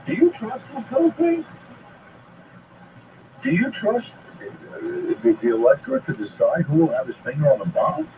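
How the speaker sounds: normal talking voice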